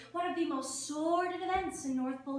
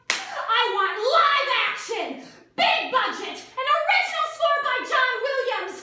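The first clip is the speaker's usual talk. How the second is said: high, loud, punched-out ultimatum; loud diva demands; 7 semitones higher, 8 dB louder than usual. Acted. Film star laying down terms, loud and demanding. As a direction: belt out each demand like a star.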